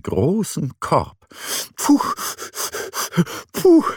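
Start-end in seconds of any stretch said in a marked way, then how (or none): 1.8-3.8 puffing. A narrator's reading. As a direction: puff out hard under a heavy basket. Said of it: "schnauft er".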